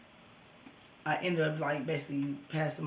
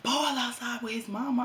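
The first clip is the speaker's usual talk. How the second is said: urgent news; 7 semitones higher, 5 dB louder than usual. Retold or imitated. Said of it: "she like".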